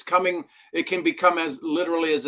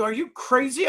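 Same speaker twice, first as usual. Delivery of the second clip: mock incredulous; 11 semitones higher than usual. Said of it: "I go".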